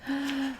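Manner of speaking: soft audible gasp. Pretend. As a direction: gasp in mock alarm. Reader reacts to the story.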